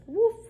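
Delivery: high, yappy woof woof; puppy barking. Acted. Biscuit the puppy barks at the vet, high and yappy. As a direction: yap like a small eager puppy.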